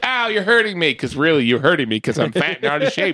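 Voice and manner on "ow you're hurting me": hammed-up cry of pain; mock wrestler selling pain. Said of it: "I could sell like a motherfucker".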